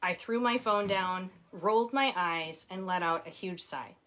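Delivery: ordinary delivery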